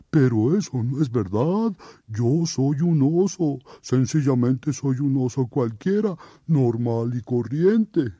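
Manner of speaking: oso firme y sencillo; bear folk. Acted. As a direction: explica con calma que es oso. Oso protesta. (oso que protesta)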